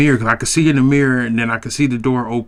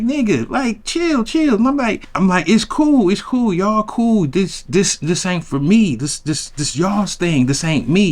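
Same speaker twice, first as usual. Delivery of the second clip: much higher, hurried "it's cool, y'all cool"; flustered brush-off; 7 semitones higher than usual. Retold or imitated. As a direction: wave them off while backing away. Himself declining, flustered.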